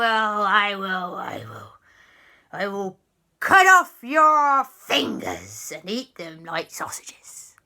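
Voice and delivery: pirate grumble building to a threat; grumble, then draw out the threat; annoyed pirate ghost. Acted. Pirate ghost threatens Papa, theatrical annoyance.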